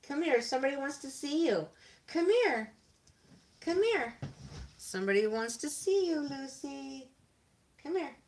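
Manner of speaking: high-pitched dog-calling voice; coaxing a pet. Real, not acted. Owner coaxing napping dog to come, high pet voice. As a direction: coax the dog over with quick, high, falling calls.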